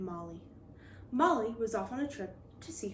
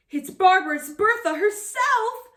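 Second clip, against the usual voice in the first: a much higher, louder wail; 11 semitones higher, 9 dB louder than usual. Acted. Terrified crew recognising Bertha, wailing. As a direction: wail it out in terror. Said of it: "wailed the crew".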